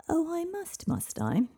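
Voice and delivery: high, mocking sneer of a dragon. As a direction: scoff at her, amused.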